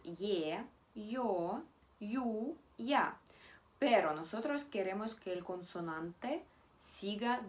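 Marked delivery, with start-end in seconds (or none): 0.0-3.2 ye, yo, yu, ya spelled out slowly and distinctly, rising at the end; spelling out the Russian vowels ye, yo, yu, ya clearly; soft vowels said aloud. Shown as a demonstration. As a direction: sound out ye, yo, yu, ya one by one. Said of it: "Son las siguientes".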